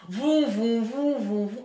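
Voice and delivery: loud engine vroom imitation; car engine noise. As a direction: make the car roar off.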